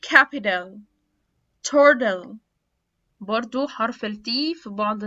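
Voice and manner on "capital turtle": louder "capital" as "capidal"; "turtle" as "turdle", D for T; American flapped T. Shown as a demonstration. Teacher drilling T plus L examples, flapped T. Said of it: "هيتنطق D".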